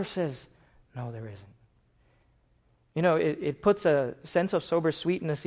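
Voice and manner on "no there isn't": quiet answer. Acted.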